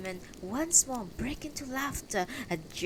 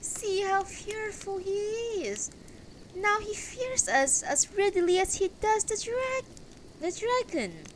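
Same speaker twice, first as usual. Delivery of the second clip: very high, jeering crone; mocking high-pitched old hag; raspy voice; 14 semitones higher than usual. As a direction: taunt him in a high, raspy voice. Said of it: "the raspy voice behind says".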